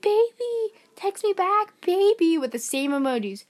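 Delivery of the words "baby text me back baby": high, hammed-up flirty texting voice; mock flirty. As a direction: pitch it up, playfully imitating a come-on. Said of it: "started going all freaky mode".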